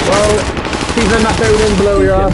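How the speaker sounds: loud callout over the game; yelling across the room. Real, not acted. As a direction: shout it out to your teammate.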